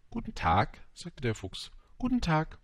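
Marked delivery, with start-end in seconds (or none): 2.0-2.6 polite greeting. A narrator's reading. Little prince politely answering the fox's greeting, polite. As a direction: return the greeting politely, pitched up. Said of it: "antwortete höflich der kleine Prinz".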